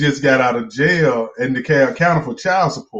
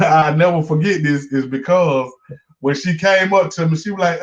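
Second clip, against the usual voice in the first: ordinary talk, nothing marked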